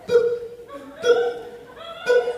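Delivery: high rising beep tones; beep imitation. Describing the studio countdown, high beeps.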